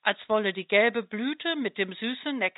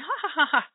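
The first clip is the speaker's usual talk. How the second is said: teasing laugh; 6 semitones higher, faster than usual. Acted. Butterfly laughs at flying flower.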